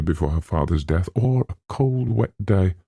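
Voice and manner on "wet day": ordinary delivery, nothing marked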